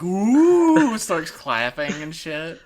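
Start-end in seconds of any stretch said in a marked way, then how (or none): 0.0-1.0 loud high crowd "oooh"; audience "ooh". Retold or imitated. Talk show crowd hearing his English, mock awed ooh. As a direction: imitate an impressed audience's drawn-out ooh. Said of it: "everybody's like".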